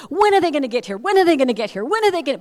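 put-on high, loud, impatient kid; insistent, bursting mock-child voice; excited child imitation. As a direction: pester eagerly like an overexcited boy. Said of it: "in his excitement".

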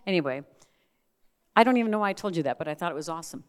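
ordinary talk, nothing marked

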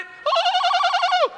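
long, very high sustained hoot; attempted loon call. Shown as a demonstration. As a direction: hold a high loon wail. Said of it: "I can't do it".

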